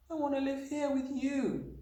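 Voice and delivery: put-on very high child's voice; small, high, imploring voice; pleading cry. A narrator's reading. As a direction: beg like a desperate little girl. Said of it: "Matilda cried out".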